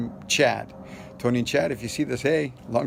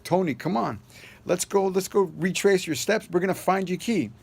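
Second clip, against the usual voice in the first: eager urging; 8 semitones higher than usual. Retold or imitated.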